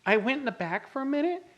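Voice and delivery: high, urgent retelling; sister's alarmed report. Retold or imitated. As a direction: break the bad news breathlessly. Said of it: "she goes".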